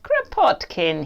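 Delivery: high, loud, slow sneer of a name. A narrator's reading. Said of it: "he sneers".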